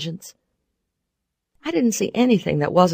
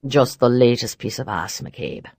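deep, cold and offhand; low dismissive voice; 6 semitones lower than usual. Acted. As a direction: brush her off coldly. Barrons dismissive.

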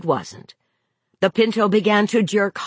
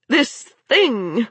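exasperated outburst; 5 semitones higher than usual. A narrator's reading. Mother venting at broken-down car.

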